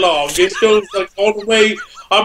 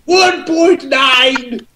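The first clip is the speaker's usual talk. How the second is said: mock announcer; 3 semitones higher, much slower than usual. Slow announcement of section.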